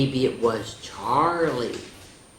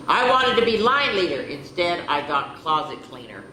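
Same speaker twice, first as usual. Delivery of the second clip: lively storybook read-aloud, a bit higher; animated picture-book narration; reading to children; 4 semitones higher, 4 dB louder than usual. A narrator's reading. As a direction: read the diary like a bedtime story. Reads diary entries aloud.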